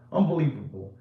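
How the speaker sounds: usual speaking voice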